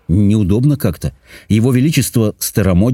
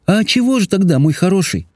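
high, affectionate princess voice; sweet girlish soothing question; coaxing princess; 10 semitones higher than usual. A narrator's reading. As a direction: ask fondly, pitched well up. Princess coaxes the dragon.

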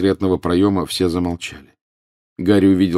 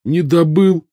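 high, louder dismayed question; crestfallen; 12 semitones higher, 7 dB louder, slower than usual. A narrator's reading. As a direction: ask with sudden disappointment. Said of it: "спросил сразу приунывший Рон".

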